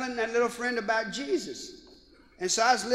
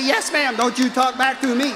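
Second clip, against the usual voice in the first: mama scolding; 3 semitones higher, 6 dB louder than usual. Retold or imitated. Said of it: "She be saying".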